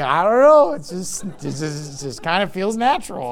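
a hint of a Southern accent, mumbled. Shrugging off critics in a light Southern accent. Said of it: "you slip into a Southern accent".

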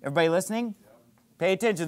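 ordinary delivery

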